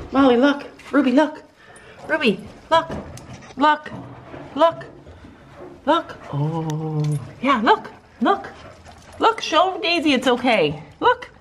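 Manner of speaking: high, rising call to look; high coaxing. Real, not acted. Owner urging scared dogs to look, high and rising.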